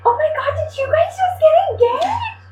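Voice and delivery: be the thrilled lady on the beach; a lady on the beach gushing at the couple, high-pitched. Retold or imitated. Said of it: "a lady was like".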